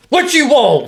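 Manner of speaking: high, loud mock tough-guy line. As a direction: bark it like a deadpan tough guy. Quoting a guy from the video.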